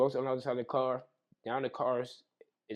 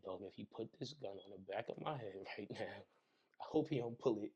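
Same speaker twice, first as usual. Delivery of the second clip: fearful inner thought; 4 semitones lower, 10 dB quieter than usual. Retold or imitated.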